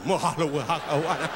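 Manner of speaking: lazy mock Hawaiian phrase with a laugh; mocking island locals, mock Hawaiian. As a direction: toss off a put-on island voice, chuckling.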